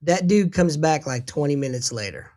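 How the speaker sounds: usual speaking voice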